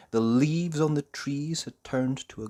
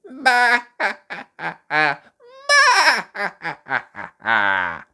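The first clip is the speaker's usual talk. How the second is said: high loud baa-ha bleating laughter; 9 semitones higher, 10 dB louder than usual. Acted. The sheep joining in the laughter, bleating laughs. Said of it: "even the sheep started to laugh".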